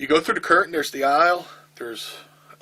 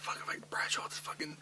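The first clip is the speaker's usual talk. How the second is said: urgent retold whisper; 2 semitones higher, 13 dB quieter than usual. Telling Tracy Smothers what Bradshaw did, in a whisper. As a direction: hiss it under your breath.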